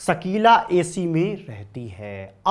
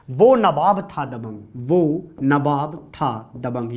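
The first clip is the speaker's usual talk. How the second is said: slow mnemonic line; 2 semitones lower, slower than usual. Shown as a demonstration. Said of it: "दोबारा सुनिएगा".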